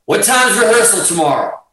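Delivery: high-pitched call across a distance; calling up stairs. Retold or imitated. Guitarist asking Gary atop a far stairwell, calling up. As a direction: pitch it up to reach someone far off. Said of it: "the stairwell's far".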